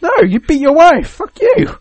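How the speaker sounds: high, loud telling-off; jeering bystander shouting down the wife-beater; mock shout. Someone telling off Hank Pym.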